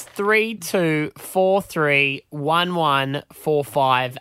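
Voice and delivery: slow, deliberate number reading; slow dictation. Shown as a demonstration. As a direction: spell it out slowly for listeners. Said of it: "reads number slowly".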